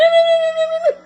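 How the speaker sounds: a high squealing laugh out loud; high shrieking laugh. Real, not acted. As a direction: burst into a shrill high laugh. Laughing at her own act.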